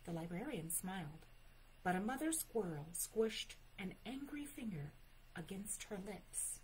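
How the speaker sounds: plain reading voice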